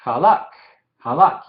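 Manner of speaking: halak with a rough h and a silent final shewa; Hebrew halak. Shown as a demonstration. Teacher models halak for the class, final k clipped. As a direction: end Halak, halak on the k, no vowel after. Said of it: "notan el Shewa silencioso al final".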